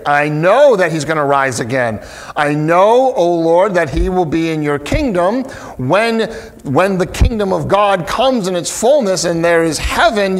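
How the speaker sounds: ordinary delivery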